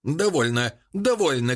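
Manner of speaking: mild cry, jumping high on the repeat; protesting cry. A narrator's reading. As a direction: cut them off, pitch leaping up. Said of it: "крикнул маленький французский священник с протестующим жестом". (протестующий возглас повышенным тоном)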